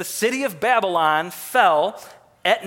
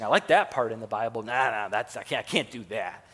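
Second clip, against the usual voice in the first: a sneering 'nah' as if waving pages away; mock dismissive picker; 6 semitones lower, 4 dB quieter than usual. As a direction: lower and softer, brushing verses aside. Mocking people who pick verses.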